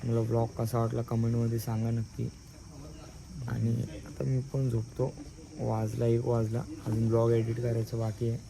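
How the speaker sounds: soft goodnight sign-off; quiet at night. Real, not acted. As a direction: say goodnight softly, others asleep. Signing off at night, others asleep.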